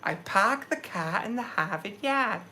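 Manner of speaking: Boston accent. Shown as a demonstration. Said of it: "I didn't get the".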